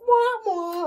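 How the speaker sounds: high-pitched imitated sad-trombone wah-wah; mocking wah-wah. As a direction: mimic a teasing "wah, wah" high up. Niece's retort to weird remarks, imitated.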